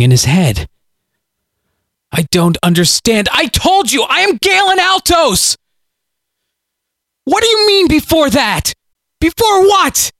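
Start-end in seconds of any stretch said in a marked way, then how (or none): 2.1-5.6 desperate shout. Acted. Tormented shouting at voice. 7.2-10.1 frantic cry. Acted. Frantic questions to voice.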